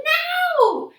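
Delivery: high, loud urgent shout; yelled. A narrator's reading. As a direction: yell at Percy to get out. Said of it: "Annabeth yelled at me".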